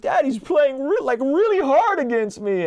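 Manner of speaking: put-on high, whiny little kid's complaint; imitating son. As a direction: pitch way up like a small upset boy. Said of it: "And he's like".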